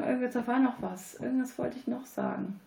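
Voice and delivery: normal talking voice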